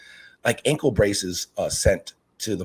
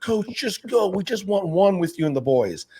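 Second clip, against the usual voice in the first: much higher, coaxing parent voice; pleading parent; 9 semitones higher than usual. Retold or imitated. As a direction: lightly play a parent coaxing the coach. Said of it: "the parents are like".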